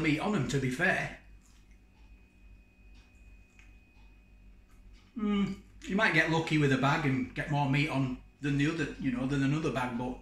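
speaking between bites of rib; talking while chewing. Real, not acted. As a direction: mouth partly full of meat. Seen 2: Holds rib throughout while talking; bites it around 5.3-6.2 s.

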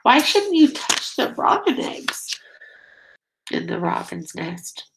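ordinary delivery

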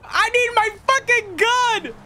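high, slow furious yell; angry shout. Acted. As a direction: roar it out, desperate for the gun. Angry shout for his gun.

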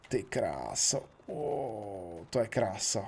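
low, soft admiration; hushed awe. Real, not acted. Gamer seeing the finished motorbike paint, awestruck. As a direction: breathe it out in quiet wonder.

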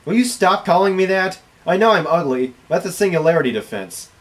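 ordinary talk, nothing marked

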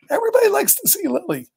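cooing. Real, not acted. Cooing about the visiting dog.

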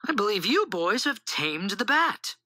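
proudly praising, voice lifted high. A narrator's reading. Mother praises her boys for taming the bat, proudly. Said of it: "said Mother proudly".

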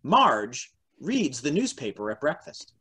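ordinary talk

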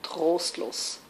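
low, soft, subdued close; understated sorrowful verse; desolate poem ending. Acted. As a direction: sink into quiet hopelessness. Poem turns desolate at its end.

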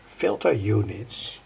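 ordinary delivery

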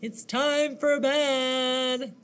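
slow, drawn-out bedtime call; slow sing-song mom voice; storybook mom. Acted. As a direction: imitate Mom stretching out the bad news. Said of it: "what did his mom say".

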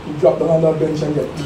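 ordinary talk

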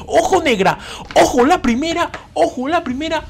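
very high-pitched excited squeal; excited alert. Real, not acted. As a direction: squeal it as if the prize just appeared.

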